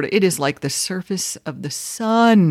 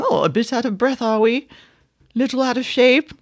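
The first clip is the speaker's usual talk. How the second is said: high-pitched teasing imitation; 7 semitones higher than usual. Singer to winded interviewer live onstage, imitated chuckling tease. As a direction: lightly mimic an amused rock singer, pitched up. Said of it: "[name] starts laughing and chuckling and saying".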